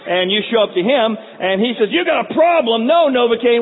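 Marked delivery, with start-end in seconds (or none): 1.9-3.6 gleeful high loud mock dentist; sadistic dentist. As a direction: bark like a cartoon sadist dentist. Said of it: "the dentist from Little Shop of Horrors".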